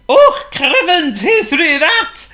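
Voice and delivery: very high, loud Scottish outcry with a laugh, slow; a Scot yelping after a snowball hits him; startled Scottish accent. Acted. Scottish postman hit by a snowball yelps out, in a Scottish accent.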